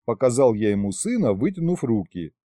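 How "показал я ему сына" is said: usual speaking voice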